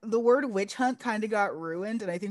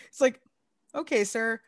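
ordinary talk

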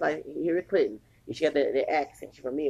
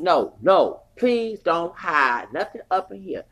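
retold firm plea; 3 semitones higher, 6 dB louder, slower than usual. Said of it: "I said".

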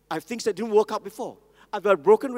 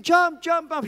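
higher, louder urging call; crowd shouting; 9 semitones higher, 7 dB louder, slower than usual. Retold or imitated. Crowd below the burning building, loud urging shout. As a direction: shout up to the man on the ledge. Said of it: "Everybody was saying".

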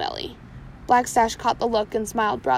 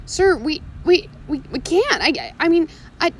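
sputtering; 5 semitones higher, 5 dB louder than usual. Acted. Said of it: "Shmee sputtered".

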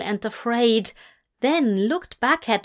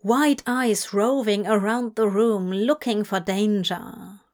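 plain reading voice

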